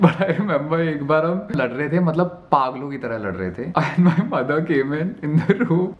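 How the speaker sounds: giggling through the memory; laughing as he recalls the sibling fight; laughing while telling. Real, not acted. Telling fans about a fight while laughing. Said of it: "I don't know why I'm laughing".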